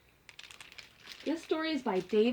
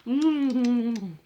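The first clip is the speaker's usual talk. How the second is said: delighted gasp; 5 dB louder than usual. Acted. Reacting to candy house.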